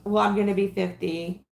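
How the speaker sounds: normal talking voice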